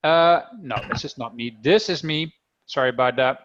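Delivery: ordinary talk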